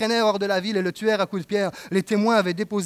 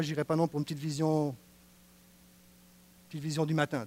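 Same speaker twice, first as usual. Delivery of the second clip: normal talking voice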